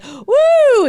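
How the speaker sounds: loud, high "woo"; long high comic whoop; mock-alarmed whoop. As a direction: whoop as if dodging trouble. Acted reaction to French critics.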